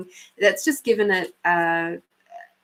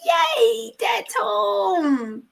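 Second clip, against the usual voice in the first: much higher, slow, squeaky puppy voice; the dog cheering that Dad is home, in a much higher voice; voicing the dog; 11 semitones higher, much slower than usual. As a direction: speak for the overjoyed chihuahua. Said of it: "He's like".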